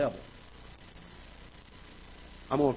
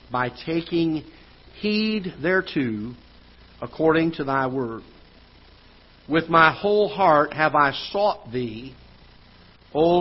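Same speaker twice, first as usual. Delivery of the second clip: scripture reading; 2 semitones higher, 3 dB louder than usual. Acted. Reads psalm aloud.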